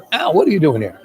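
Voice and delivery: mild mock surprise of a woken father; surprised. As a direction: sound caught off guard at the door. Father finds boy on doorstep.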